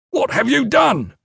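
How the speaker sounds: high, loud shout of disbelief; outraged shout. A narrator's reading. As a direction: shout it at the culprit. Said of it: "he shouted at Cruxer".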